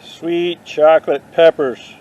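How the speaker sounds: drawn out slowly, as if tasting a band name; Sweet chocolate peppers said slowly with a lingering "Sweet"; slow savouring. Pretend. Trying it out as a band name, slow and savouring. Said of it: "I'm gonna name my next band that".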